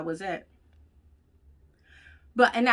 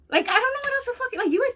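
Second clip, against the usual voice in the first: exasperated; 3 dB louder, faster than usual. Real, not acted. Exasperated high fast.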